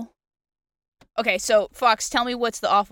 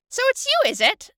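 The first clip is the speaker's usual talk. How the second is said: put-on much higher, creaky old woman; old lady voice; 5 dB louder than usual. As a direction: play a sly old woman, pitched way up. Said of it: "I get to pull out my old lady voice".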